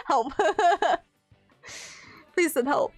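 giggling please send help; laughing plea. Pretend. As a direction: laugh through a mock cry for help.

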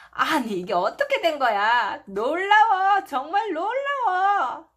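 loud, high amazed cry; astonished. Acted. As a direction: burst out in wonder. Astonished at classmates. (깜짝 놀라 감탄하는 목소리)

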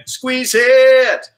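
high, loud coaching shout; shouted cue. Real, not acted. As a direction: punch the cue out. Coach calling drill cue.

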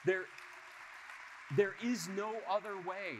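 slow, loud and high, driving the point home; emphatic declaration. Real, not acted. Speaker to audience after the story, slow loud emphatic declaration. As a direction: hammer home that there is no other way.

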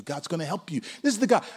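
normal talking voice

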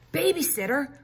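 put-on girl shocked at news of a babysitter; gasped. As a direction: gasp out the word in disbelief. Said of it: "Lulu gasped".

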